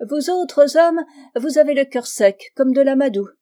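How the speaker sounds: high, loud, emphatic reproach; scolding spinster. A narrator's reading. Spinster reproaching the diplomat about men, scolding.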